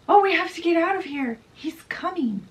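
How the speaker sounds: high, loud and fast panicked warning; alarmed siren. Acted. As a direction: rush the warning out in fright. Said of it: "the siren is like".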